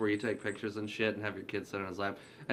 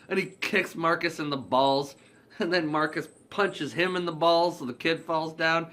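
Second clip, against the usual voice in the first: ordinary talk, nothing marked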